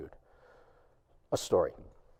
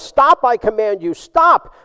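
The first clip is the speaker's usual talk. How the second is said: higher, louder desperate yell; 7 semitones higher, 5 dB louder than usual. Acted. Officer yelling at the man in rags during the sword fight. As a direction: shout the order mid swordfight. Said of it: "And he yells".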